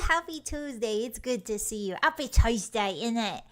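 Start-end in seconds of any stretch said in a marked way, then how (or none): 2.0-3.4 put-on playful British accent. Streamer greeting viewers on Tuesday, playful British accent. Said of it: "like British for a bit".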